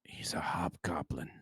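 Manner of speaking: slow breathy whisper to the group; hushed secret whisper. Acted. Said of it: "I'm gonna whisper to the group".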